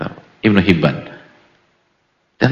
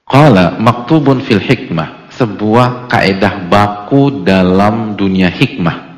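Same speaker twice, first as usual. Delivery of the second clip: slow emphatic quote; 7 dB louder, much slower than usual. Acted.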